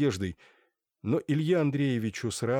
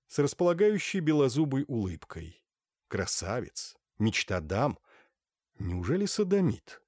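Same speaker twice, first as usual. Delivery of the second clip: ordinary delivery, nothing marked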